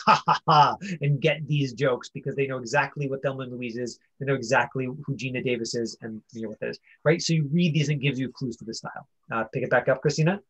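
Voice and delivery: usual speaking voice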